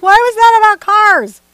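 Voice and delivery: high, loud baffled squeal at an ad; baffled outburst. Retold or imitated. Painter mocking a confusing car ad, high baffled squeal. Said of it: "I'll be like".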